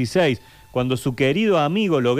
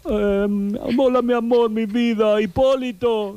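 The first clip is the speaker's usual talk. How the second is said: put-on high, sweet old lady voice; Ana María impression; 8 semitones higher, slower than usual. Impersonating Ana María greeting Hipólito. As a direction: coo it like a fond grandma. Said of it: "vamos con una Ana María".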